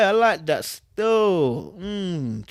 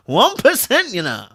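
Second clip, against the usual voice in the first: mock quote; 6 semitones higher, 6 dB louder than usual. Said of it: "Somebody came out here and said".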